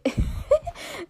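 high squeaky burst of laughter. Real, not acted. To her viewers after hearing her own voice crack, a high squeaky laugh.

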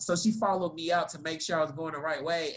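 usual speaking voice

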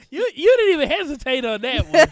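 loud, laughing disbelief. Real, not acted. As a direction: laugh through the words. Laughing at quick answer.

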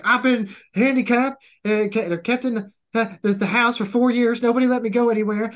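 put-on sheltered kid voice. West Point kid imitated.

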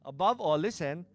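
loud, rising preacher reading of scripture; preaching scripture. Acted. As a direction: proclaim the verse with growing force.

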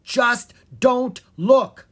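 loud, high warning, slowing on the last words; urgent shout. A narrator's reading. Bard rushes to the prince, shouting a warning. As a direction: call out, then stress each word slowly. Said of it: "shouted Will".

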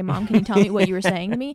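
loud, pointed ask; loud demanding question. Retold or imitated. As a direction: demand an answer loudly. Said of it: "I'm gonna say".

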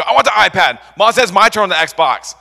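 put-on high, loud squabbling kid voice; whiny kid. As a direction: whine like a kid grabbing the iPad. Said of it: "We go".

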